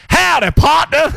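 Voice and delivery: high, slow shouted Texan drawl; Texan accent. Retold or imitated. Greeting a stranger in Texas, shouted, Texan accent. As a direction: holler it like a cowboy in a Texan accent. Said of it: "you go to Texas".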